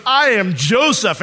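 high, loud, slow announcement; dramatic proclamation. Acted. Joseph looks up at his brothers, loud dramatic proclamation. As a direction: proclaim it as a thunderclap reveal. Retold revelation at dramatic climax.

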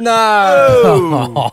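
loud, high drawn-out no; horrified disbelief. Real, not acted. Host hearing of missed McDonald's shares, horrified disbelief. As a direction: react aghast to the news. Hosts hear of lost share offer.

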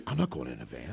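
low, stubborn refusal; paranoid wife. Retold or imitated. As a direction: dig in, scared and stoned.

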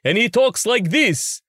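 high, slow Count Dracula parody; Count Von Count. Shown as a demonstration. Puppeteer demonstrates a Dracula parody, high slow count voice. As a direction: draw it out high like a cartoon vampire count. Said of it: "we have a character who's Count Von Count".

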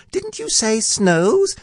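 high, slow squeak; small, high-pitched query; questioning Piglet voice. A narrator's reading. Piglet interrupts Pooh's hum, squeaking.

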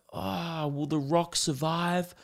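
soft, slow, drawn-out fretting; mock-concerned viewer. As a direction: pretend to worry for the hero. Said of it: "you're supposed to be like".

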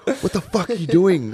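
high, laughing mock of an angry boss; angry manager. The club manager yells at the drunk DJ, laughing. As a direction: play the fuming manager half-laughing.

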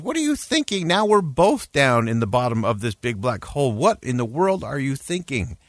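high-pitched, exasperated disbelief, retold; mock-aghast, leaping up in pitch on the questions; acted incredulity. Trapped man to the friend who jumped in, acted incredulity.

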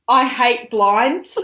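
slow, loud mock yell; hammed-up declaration; out-of-breath yell. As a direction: bellow it like a fed-up husband. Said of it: "he said".

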